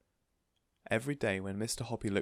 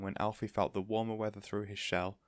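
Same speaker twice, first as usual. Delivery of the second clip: ordinary delivery, nothing marked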